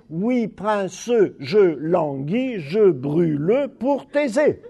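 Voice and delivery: mock the stilted classroom reading of "je brûle pour Thésée"; overdone full recitation. Shown as a demonstration. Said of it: "Nobody will say".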